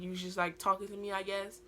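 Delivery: usual speaking voice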